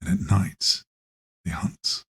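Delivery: ominous narrator, fading to a whisper; nature documentary narrator. Acted. TV wildlife presenter warns of night hunters, ominous. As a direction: warn slowly, dropping away into a hush. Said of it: "it's some dude talking about lions and tigers".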